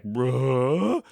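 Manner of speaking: puzzled dog's noise, sliding up in pitch; Scooby-Doo. Retold or imitated. Dog hears a new sound, puzzled whimper-growl. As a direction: imitate a baffled dog tilting its head. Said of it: "She didn't do the Scooby-Doo".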